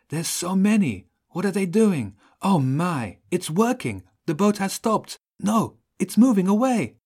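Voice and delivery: high-pitched breathless-with-wonder excitement; excited. A narrator's reading. Child radios that the boat is moving away, excited. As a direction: exclaim with mounting excitement, high and loud by the end.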